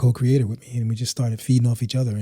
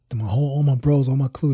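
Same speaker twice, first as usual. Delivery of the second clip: ordinary delivery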